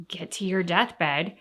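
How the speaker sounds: ordinary talk, nothing marked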